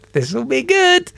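high-pitched scheming thought; acted-out prankster's anticipation; gleeful scheming. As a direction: relish the mischief to come. Plotting a prank, retold thought.

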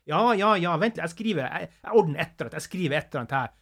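busy, helpful north Norwegian dialect voice; north Norwegian accent. Retold or imitated. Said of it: "som nordlending, en annen nordlending".

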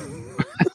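a quick, very high, quiet giggle; soft high giggle. Real, not acted. Admitting they never told the guy the truth, a quiet high giggle.

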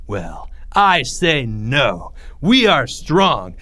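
very slow, emphatic declaration; resolute oratory. A narrator's reading. Greg rallies boys from the bench, resolute. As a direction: land each word firmly for the crowd. Greg's rallying speech continues.

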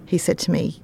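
normal talking voice

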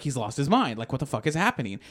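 usual speaking voice

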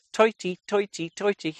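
high, chirpy Irish toity; mock Irish "thirty". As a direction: say toity in a hammed-up Irish accent, repeated high. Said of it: "It's like an Irish person saying".

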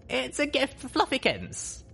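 high, gentle little pony voice; young filly voice. Acted. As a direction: voice Lil Pip small and sweet. Lil Pip's voice, high.

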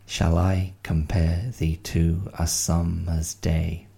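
slow, even iambic beat; reading Shakespeare on the beat; metered reading. Shown as a demonstration. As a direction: land every second syllable. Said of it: "There you go".

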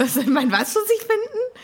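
high, incredulous question; baffled Harry. Retold or imitated. Harry questions the elf's odd word, high and baffled.

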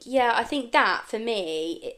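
usual speaking voice

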